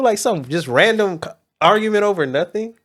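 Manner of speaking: ordinary talk, nothing marked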